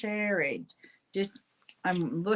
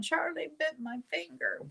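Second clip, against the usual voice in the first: put-on much higher little-girl voice; granddaughter's quote; 11 semitones higher than usual. Grandmother retelling her granddaughter's complaint about the dog, high childlike voice. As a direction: lightly voice the four-year-old granddaughter. She's running around going.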